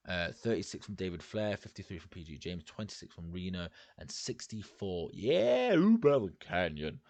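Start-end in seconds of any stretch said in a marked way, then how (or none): 5.1-7.0 high, loud burst of delight; excited cheer. Real, not acted. Reviewer cheering Kanyon's top score, excited.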